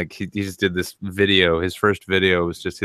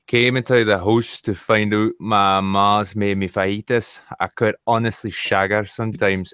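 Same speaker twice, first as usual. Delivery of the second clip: put-on Scottish accent. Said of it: "When the Scots write".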